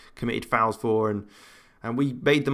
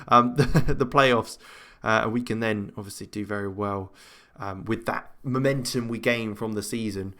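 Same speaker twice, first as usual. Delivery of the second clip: ordinary talk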